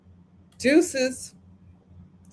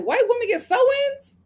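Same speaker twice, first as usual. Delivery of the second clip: shocked squeal; 12 semitones higher than usual. Retold or imitated. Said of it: "I was like".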